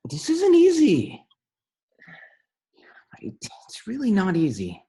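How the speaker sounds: high, loud and slow, voice straining; anguished. Acted. Rock grieving his lost love, straining high and slow. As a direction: push the words out through grief.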